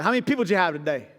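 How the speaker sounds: quick, mildly doubting question; imitating a skeptical father-in-law. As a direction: ask it fast, a touch dubious. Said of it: "he's a little skeptical".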